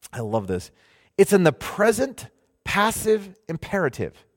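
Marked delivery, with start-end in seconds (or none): none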